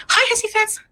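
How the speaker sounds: sing-song high mocking hello; sarcastic greeting. Real, not acted. Streamer taunting a hater, sugary and mocking.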